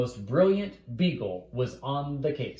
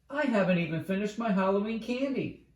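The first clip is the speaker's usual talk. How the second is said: higher, whiny mock wail; 7 semitones higher, faster than usual. Sally whining about the holiday, mock wail. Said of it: "Sally wailed".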